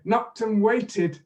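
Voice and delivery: teacher modelling for repetition; drill lead. Shown as a demonstration. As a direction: lead the drill slowly and clearly. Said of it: "repeat after me".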